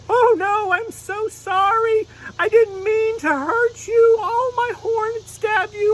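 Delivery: very high, slow, pleading unicorn voice; little unicorn begging forgiveness, near tears; sorry unicorn puppet. Pretend. Unicorn puppet apologizing to the pastor, very high and pleading.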